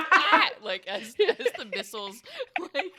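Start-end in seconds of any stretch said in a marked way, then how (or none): 0.1-0.5 high, loud shriek that snaps; snapping shriek. Pretend. Creepy crawling familiar shrieking and snapping at missiles. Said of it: "like, snaps at".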